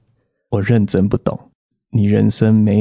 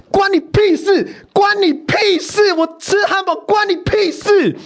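high, slow, shouted rant; furious mock outburst; slower than usual. In the elevator, venting at the nosy manager in a shouting rage. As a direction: explode with pent-up rage, stretching each word. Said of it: "就开始捶墙壁".

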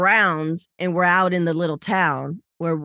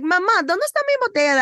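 put-on high, fast little kid asking in Spanish; small son asking his mother for his bottle, kid voice; little boy voice; 10 semitones higher, much faster than usual. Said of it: "He was like, he's like".

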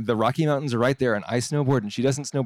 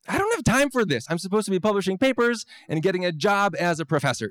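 frustrated, pitched much higher; exasperated inner voice; 7 semitones higher than usual. Retold or imitated. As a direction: snap it out like you're fed up.

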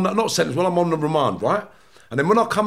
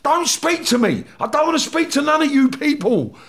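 retold angry outburst; 6 semitones higher than usual. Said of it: "I just had to say to people".

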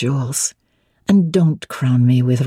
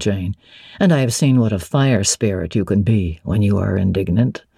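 voiced lower as the brooding master; Mr. Rochester; 7 semitones lower than usual. A narrator's reading. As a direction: drop low and weighty, wary of her question.

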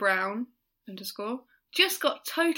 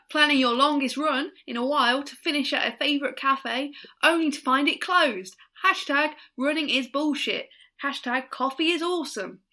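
put-on higher, all-over-the-place New Zealand accent; bad New Zealand accent; 4 semitones higher, 4 dB louder than usual. Co-host retrying a listener's tweet, wandering New Zealand accent. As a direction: have an amateur go at New Zealand. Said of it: "That was all over the place".

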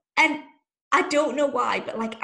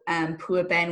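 normal talking voice

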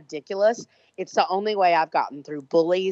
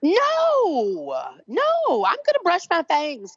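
much higher, squeaky playful fangs voice; mock vampire defiance; 6 semitones higher, 4 dB louder, slower than usual. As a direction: ham up a defiant little vampire.

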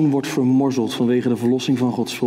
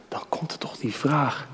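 whispered setup; 7 dB quieter than usual. Real, not acted. Preacher leading listeners to the question, whispering. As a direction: lean in and whisper.

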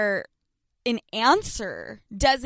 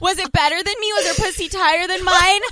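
higher, louder, rapid-fire questions; gleefully grilling him for details; mock eager interrogation; 6 semitones higher, 5 dB louder, faster than usual. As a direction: pile on nosy questions breathlessly. Rapid nosy questions to boyfriend.

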